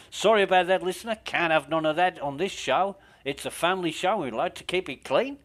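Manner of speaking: hammed-up Australian DJ smoothing it over; Australian accent. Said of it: "my Australian accent's shit".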